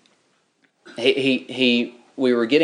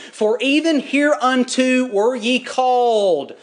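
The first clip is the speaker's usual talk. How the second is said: preached scripture, higher, slow and solemn; scripture reading; 6 semitones higher, slower than usual. Acted. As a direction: read the verses like a preacher lifting the Word. Reads scripture.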